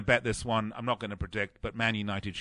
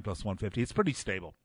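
ordinary talk, nothing marked

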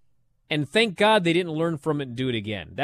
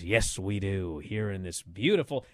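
low, soft, deflated announcer voice; Tony Schiavone; 10 semitones lower, 8 dB quieter than usual. Retold or imitated. Host mocks the interviewer running out of things to say, low and soft. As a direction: let the announcer trail off, deflated. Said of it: "Tony goes".

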